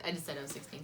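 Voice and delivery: ordinary talk, nothing marked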